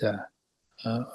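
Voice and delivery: ordinary talk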